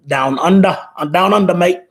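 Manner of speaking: hammed-up Aussie "down under, mate"; terrible Australian accent. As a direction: attempt a bad Australian accent, leaning on "under". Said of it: "That's a terrible accent".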